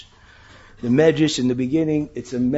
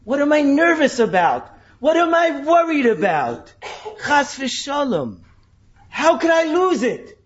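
relieved realization; 11 semitones higher, 4 dB louder than usual. Retold or imitated.

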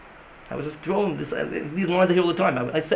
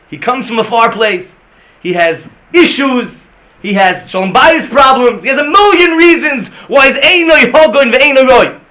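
loud, high, mounting list of excuses; slow emphatic shout, building; impassioned preaching; 7 semitones higher, 14 dB louder, slower than usual. Acted. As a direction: pile up each problem louder than the last. Preaches at full force.

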